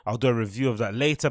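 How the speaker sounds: ordinary talk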